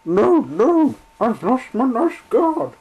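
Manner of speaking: sobbing coach. Acted. Coach crying to the engine for her lost guard, sobbing. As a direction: wail like a tearful little coach. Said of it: "she sobbed".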